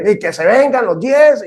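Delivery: high, cocky pretend taunt; defiant challenge. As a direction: dare the whole gang to come at you. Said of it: "Yo generalmente hubiera reclamado".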